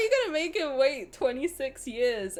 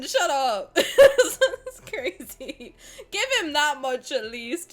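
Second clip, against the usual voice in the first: normal talking voice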